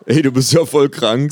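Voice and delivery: loud, brash football fan; fan's retort. A narrator's reading. Fan shouting back at the kangaroo, loud and brash.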